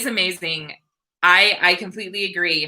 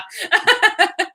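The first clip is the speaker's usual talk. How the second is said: real burst of high giggles; high laughter; 12 semitones higher than usual. Real, not acted. Wife amused by her poor husband's reaction, high laughter. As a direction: laugh it out, pitched well up.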